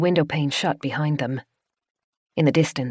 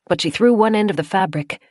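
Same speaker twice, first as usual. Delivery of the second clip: usual speaking voice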